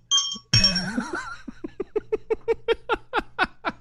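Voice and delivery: high laugh in long, rapid pulses; helpless high-pitched laughter; laughing fit. Real, not acted. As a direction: crack up in a drawn-out high cackle. Co-host cracking up at the joke.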